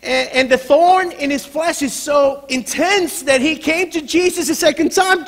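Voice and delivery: loud, high, driving preacher; impassioned preaching. Real, not acted. Preacher telling of Paul's intense thorn, impassioned. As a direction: preach at full force.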